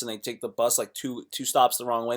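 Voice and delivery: ordinary talk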